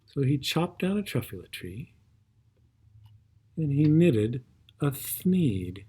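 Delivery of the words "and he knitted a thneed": plain reading voice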